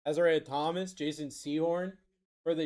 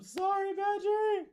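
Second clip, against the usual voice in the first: very high squealed plea; mock apology; slower than usual. Real, not acted.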